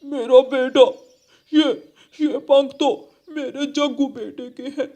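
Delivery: high, tearful father's lament; grieving wail. Acted. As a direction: wail in grief for your lost child. Father crow finds son's feathers.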